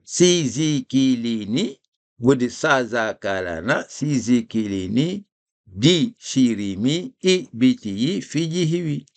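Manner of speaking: contrasting sa and si syllables; reading the list aloud for the class. Shown as a demonstration. Teacher drilling the class on i syllables. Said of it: "Mettez ça au son I".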